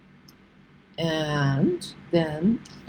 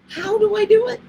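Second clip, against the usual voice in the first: exasperated plea squeaked much higher; high squeaky plea; 4 dB louder than usual. Real, not acted.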